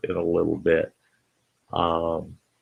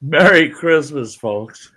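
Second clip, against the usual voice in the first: bright loud festive greeting, pitched up; cheerful greeting; 7 semitones higher, 5 dB louder than usual. Real, not acted. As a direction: call out a hearty holiday hello. Host greets live stream viewers.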